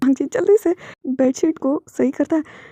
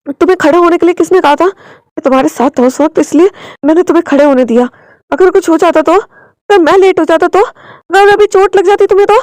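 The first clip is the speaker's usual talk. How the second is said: loud, higher furious rebuke building; husband scolding Wing for standing, loud and rising; angry scolding; 5 semitones higher, 7 dB louder than usual. Acted. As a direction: tear into him out of fear.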